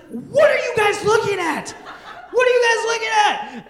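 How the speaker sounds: high, loud demanding shout; bossy older sister. Retold or imitated. Older sister catching kids with the booklet, shouting. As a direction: catch the little kids red-handed.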